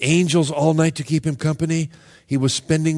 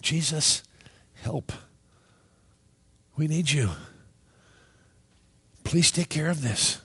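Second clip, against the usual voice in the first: calm prayer; 6 dB quieter than usual. Pretend. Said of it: "you don't panic in that".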